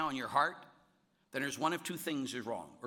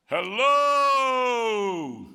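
long high booming call; mocking shout from above; 10 semitones higher, 16 dB louder than usual. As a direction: bellow down from the scaffolding as a prank. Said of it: "he says".

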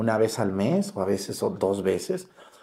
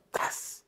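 voiceless jab sound; 10 dB quieter than usual. Retold or imitated. Imitating the jab.

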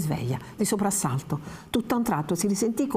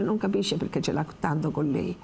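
normal talking voice

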